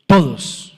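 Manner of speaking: TODOS punched loud, falling at the end; emphatic repetition. Real, not acted. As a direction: hit "todos" hard as a single word. Preacher hammers a repeated key word.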